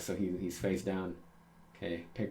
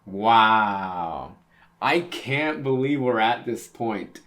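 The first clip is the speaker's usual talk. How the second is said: awed and loud; 9 dB louder, much slower than usual. Real, not acted. Reader awed at the reunion.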